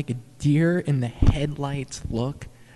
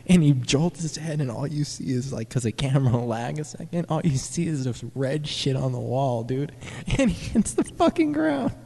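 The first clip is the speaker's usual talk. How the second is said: normal talking voice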